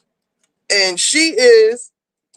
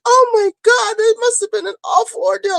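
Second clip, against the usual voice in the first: much higher, airy, overly dramatic gasp; Cynthia Nixon's dippy aunt; 12 semitones higher than usual. Retold or imitated. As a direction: play a dippy, fluttery society lady. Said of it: "Like, she talks like that".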